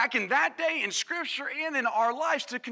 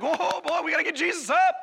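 mock alarm; 5 semitones higher, 4 dB louder, faster than usual. Imagined disciples at first big wave.

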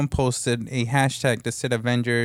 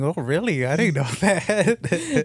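slow, higher mock wonder; fake amazement; 6 semitones higher, slower than usual. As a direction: drag it out, pretend to be amazed. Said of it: "I'm like".

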